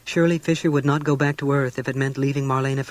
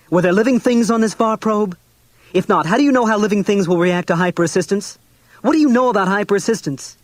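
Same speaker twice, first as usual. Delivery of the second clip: angry; 7 semitones higher, 7 dB louder than usual. A narrator's reading.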